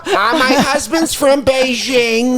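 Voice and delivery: very high, slow put-on woman's voice; hammed-up falsetto radio caller; caller from Des Moines. Imagined caller settling the torture question, put-on falsetto.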